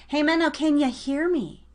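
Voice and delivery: ordinary talk, nothing marked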